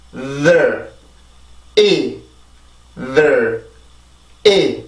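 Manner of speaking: 'there' low and falling, built up into 'either'; 'either' stressed first, falling away on 'there'; pronunciation drill. Shown as a demonstration. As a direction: drill the back syllable 'there', then join it as 'either'. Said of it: "뒤에 먼저 발음을 해볼게요".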